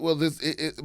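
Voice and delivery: usual speaking voice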